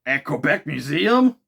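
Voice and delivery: loud incredulous question; Ned gapes at the saloon, astonished. Acted. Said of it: "cried out the Canadian".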